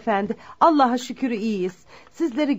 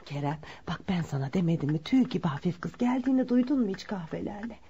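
low, softer aside to her son; hushed aside; 4 semitones lower, 9 dB quieter than usual. Acted. Mother quietly praises the girl to her son, hushed. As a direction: murmur it so the girl won't hear.

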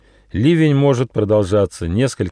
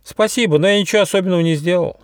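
high, hurried little squirrel; bashful squirrel voice; embarrassed; 12 semitones higher, faster than usual. Acted. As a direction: rush through it modestly, pitched high. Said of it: "смутился Бельчонок".

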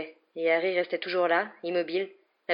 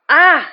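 loud high "ah!"; startled yell of pain; sudden pained cry; 10 semitones higher, 14 dB louder than usual. A narrator's reading. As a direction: cry out sharply.

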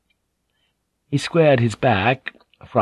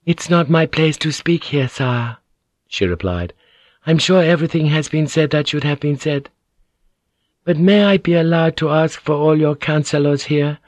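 higher, lightly voiced lady, polite; courteous noblewoman; 7 semitones higher than usual. A narrator's reading. Lady asking the council respectfully. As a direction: ask with careful courtesy. Mariko speaks to lord.

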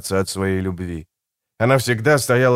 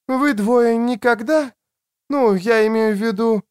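higher, halting female character; hesitant woman's voice; 10 semitones higher than usual. A narrator's reading. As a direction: feel out a delicate question as the woman. Woman asks hesitantly, trailing off.

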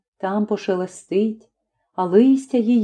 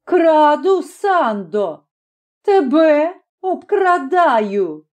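drawn-out, raised-pitch taunt; mocking admission, pitched up; defiant retort; 7 semitones higher, much slower than usual. A narrator's reading. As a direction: throw the accusation back slowly. Brother throws accusation back before striking.

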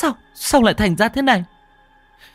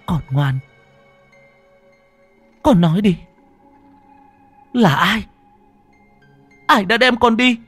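building, higher and louder fierce vow; vengeful vow; 12 semitones higher, 3 dB louder than usual. Acted. Mother swearing vengeance to her dead child. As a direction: swear revenge through clenched teeth.

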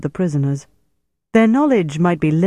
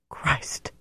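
a startled, hushed oath, much softer; frightened gasp; 10 dB quieter than usual. Acted. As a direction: breathe it out in shock. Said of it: "he took a frightened step back".

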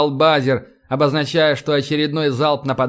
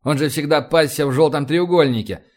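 usual speaking voice

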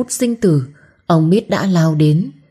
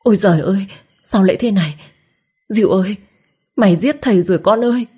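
breathless panic; 3 semitones higher, much faster than usual. A narrator's reading. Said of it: "miệng nói không ra hơi".

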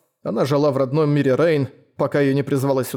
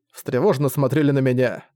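usual speaking voice

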